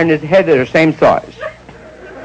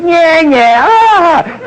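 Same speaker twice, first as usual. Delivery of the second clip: taunting laugh; 12 semitones higher, 4 dB louder, much slower than usual. Retold or imitated. Cab passenger taunting Barney.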